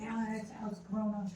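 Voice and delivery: put-on kids calling out his name. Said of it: "They're like".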